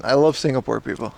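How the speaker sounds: ordinary talk, nothing marked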